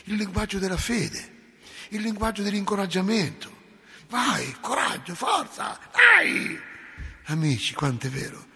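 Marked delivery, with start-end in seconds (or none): 4.1-6.6 shouted encouragement. Retold or imitated.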